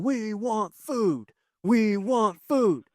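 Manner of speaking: put-on whiny kids. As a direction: drag it out like hungry children. Kids demanding food after work.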